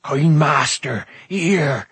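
loud, drawn-out creature's call; calling excitedly. A narrator's reading. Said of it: "Gurgi called excitedly".

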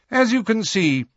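high, formal schoolmistress; McGonagall. Acted. Professor addresses her class about exams, formally.

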